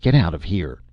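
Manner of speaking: cold, flat command. A narrator's reading. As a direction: say it without feeling. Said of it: "In a flat voice".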